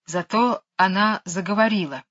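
ordinary delivery

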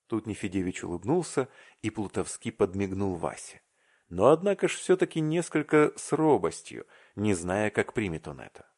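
ordinary delivery

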